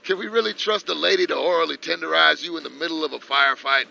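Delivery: loud, high drill sergeant address; roaring rallying speech. Pretend. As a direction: bellow it like an army commander. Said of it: "one of them army speeches".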